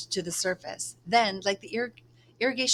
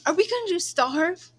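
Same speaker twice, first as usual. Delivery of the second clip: put-on frightened little girl, much higher and louder; scared child; 11 semitones higher, 6 dB louder than usual. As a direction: ask in alarm, near panic.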